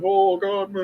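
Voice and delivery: a high, strained oh God over the sour gum; mock groan. Recalling the sour gumball challenge, a high mock groan. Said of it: "I'm like".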